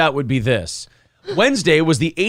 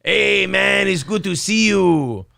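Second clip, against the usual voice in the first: lightly put-on Mexican accent; 3 semitones higher than usual. Caller greeting the host in a put-on Mexican accent. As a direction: drop into a cartoon Mexican accent. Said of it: "he did a hardcore Mexican accent".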